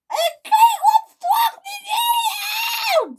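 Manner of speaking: slow, very high wailing shriek; screamed. A narrator's reading. Millie complaining about Caitlin's crash, screaming. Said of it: "screamed Millie".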